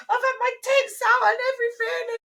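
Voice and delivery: high, loud outraged rant; shrill-pitched mock outburst; retold losing it. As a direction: rage at a late husband, re-enacted. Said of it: "literally lose it".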